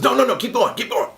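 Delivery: high, loud eager urging; urgent mock urging. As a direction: hurry him on, keep the pour going. Priest urging him to pour more.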